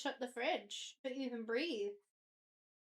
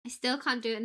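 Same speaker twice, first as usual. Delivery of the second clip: normal talking voice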